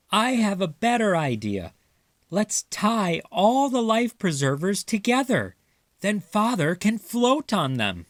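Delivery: high squeaky mouse with an idea; clever mouse. Acted. Little mouse proposing to tie the rings together, high and bright. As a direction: pitch up, bright with a plan.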